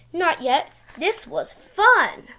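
ordinary delivery

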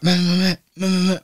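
high mock panicked nah, nah; imitating panicked driver. Mocking the panicking driver in the van, high. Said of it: "He's like".

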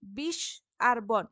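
slow, deliberate splitting into syllables; slow teacher's syllable split. Shown as a demonstration. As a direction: spell the syllables out slowly. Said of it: "যেখানে হসন্ত আছে এগুলো রুদ্ধ দল".

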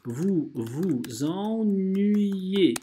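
ordinary delivery

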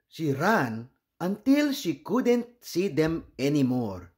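ordinary delivery, nothing marked

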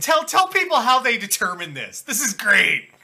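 loud, high, eager urging; excited prompting. Real, not acted. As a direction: egg your co-host on, loud and delighted. Eagerly prompting co-host to tell story.

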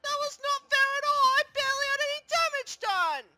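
high, loud whiny outrage; indignant protest. Acted. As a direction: protest an unfair loss at full pitch. Character protests losing unfairly.

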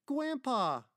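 put-on very high, loud child's cry; little child. Little girl recognising her grandfather, high joyful cry. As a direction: squeal it out like a delighted small child.

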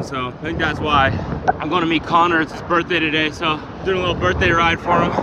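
loud and breathless on the bike; cycling hard. Real, not acted. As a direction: talk over wind mid-ride. Talking while riding hard.

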